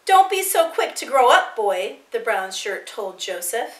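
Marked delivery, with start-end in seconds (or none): 0.1-2.0 higher, louder jeer dropping on the last word; taunting warning. A narrator's reading. Brown shirt to the boy as he leaves, taunting warning. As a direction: warn him off with scorn.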